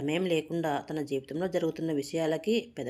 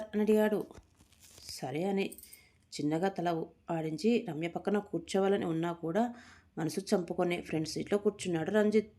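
ordinary delivery

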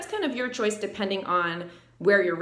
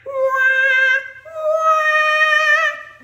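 put-on very high loud baby wail; high baby cry wah sung loud; 9 dB louder, much slower than usual. Shown as a demonstration. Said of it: "or like a baby".

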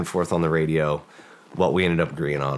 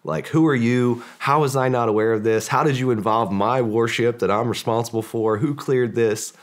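imagined angry admiral; 6 semitones higher, 3 dB louder than usual. Retold or imitated. Soldiers imagine the admiral chewing them out, mock furious. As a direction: ham up the admiral blowing a gasket. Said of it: "this dude is gonna flip a gasket".

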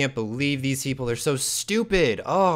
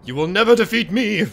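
loud, higher, mock-villain proclamation; hammed-up Grazer boast; 4 semitones higher, 6 dB louder than usual. Declaring to opponents they will never win, hammed-up boast. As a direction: boast like an over-the-top game villain.

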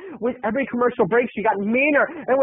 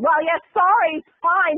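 mock Roseanne dismissive; 6 semitones higher than usual. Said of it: "Roseanne's annoying voice".

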